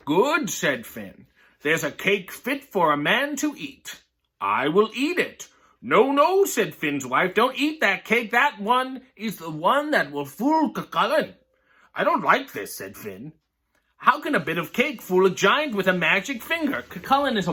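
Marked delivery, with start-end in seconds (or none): 0.1-5.5 lively read-aloud voice, characters lightly voiced; story reading. Reading a picture book to viewers, lively storytelling. 5.9-6.5 urgent no. Acted. Wife stops Finn eating cake. 6.5-16.9 lively read-aloud voice, characters lightly voiced; story reading. Reading a picture book to viewers, lively storytelling.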